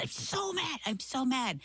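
put-on high, whiny kid's "I'm so mad"; imitating angry little boy. Mom imitating her furious son, high childlike whine.

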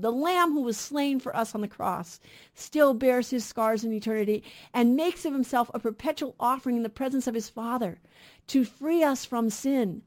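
ordinary talk

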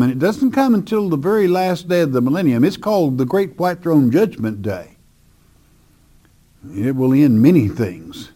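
normal talking voice

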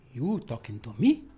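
low gangster challenge rising only on "me"; cool gangster line, jumping up at the end. Shown as a demonstration. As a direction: ask it like a movie gangster. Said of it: "that's like a gangster one".

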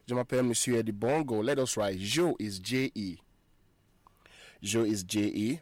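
ordinary talk

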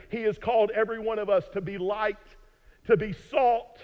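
ringing, stretched-out preacher's appeal; impassioned preaching. Real, not acted. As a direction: drive each phrase home to the congregation. Preacher exhorts the congregation.

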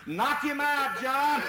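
high shouted encouragement upward; urging shout. Retold or imitated. Hunters below shouting up to the climber. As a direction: yell up into the tree.

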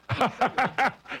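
short real laugh at own joke; quick amused chuckle. Real, not acted.

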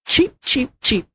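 very high, short bird cheeps; sparrow cheeping. A narrator's reading. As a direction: chirp it like a tiny sparrow. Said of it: "went the hen sparrow".

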